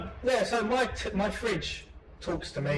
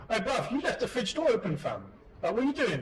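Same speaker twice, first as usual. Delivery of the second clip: higher-pitched London slang voice; London street slang accent; 6 semitones higher than usual. Acted. Fridge scolding its owner, London street slang accent.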